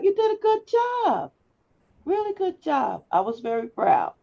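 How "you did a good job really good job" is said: bright, high, eager praise; excited praise. Real, not acted. Teacher praising class's speeches.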